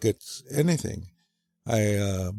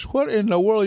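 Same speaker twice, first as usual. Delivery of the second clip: high, startled "What in the world?"; a surprised old man's exclamation; astonished grandfather; 10 semitones higher than usual. Retold or imitated. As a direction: react like you can't believe your eyes. Grandfather finds boy in outhouse.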